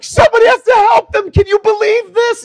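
very high, loud, frantic yelling; panicked shout. Retold or imitated. Girlfriend terrified by the movie, yelling at him. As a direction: shriek it like it's really happening. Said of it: "She's like".

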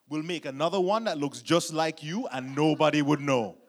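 stern Jamaican dad laying down the law; measured threat building to loud pronouncements; Jamaican father. Retold or imitated. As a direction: imitate a father warning his cheeky son. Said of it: "He gave me the same look and said".

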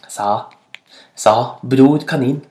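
usual speaking voice

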